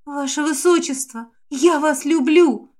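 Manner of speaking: slow, high, heartfelt blurt; blurted confession. A narrator's reading. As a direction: blurt out your love. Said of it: "выпалила девушка".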